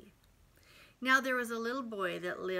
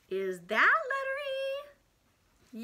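excited high question; 12 semitones higher, 5 dB louder, slower than usual. Acted. Teacher searching letter cards playfully.

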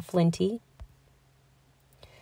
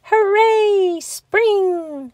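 high, loud joyful cheer; excited shout; 13 semitones higher, 3 dB louder, much slower than usual. A narrator's reading. Bear cub greeting spring, excited shout. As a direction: burst out with delight. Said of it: "shouted Little Bear excitedly".

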